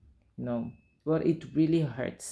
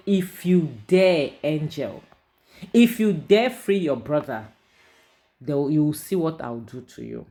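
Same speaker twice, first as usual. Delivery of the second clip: threatening husband; 2 semitones higher, 7 dB louder than usual. Retold or imitated. Husband warning wife not to free her brother, menacing. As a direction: lean in and threaten her, louder.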